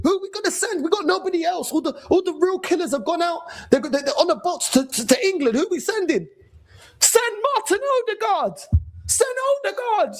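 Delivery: high, frantic mock villager calling out; panicked villager. Panicked villager begging the elders to send someone, frantic. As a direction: play a desperate villager with nobody left to send.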